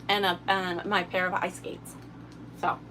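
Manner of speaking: ordinary talk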